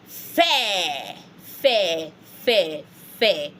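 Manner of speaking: "Fair" as open feh, no final consonant; first "Fair" drawn out, then shorter for echoing; French repetition drill. Shown as a demonstration. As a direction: repeat "Fair" in spaced falling syllables. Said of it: "Try to say it".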